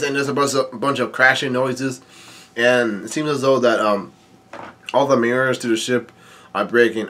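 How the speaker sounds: plain reading voice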